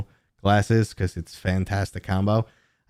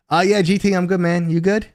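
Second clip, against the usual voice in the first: loud greeting; 10 semitones higher, 5 dB louder than usual. Real, not acted. Calling out to chat friend.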